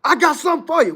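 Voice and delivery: loud, high scolding dad threat; angry father. Retold or imitated. Father scolding his misbehaving son, loud threat. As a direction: bark it like a dad about to punish. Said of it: "He said".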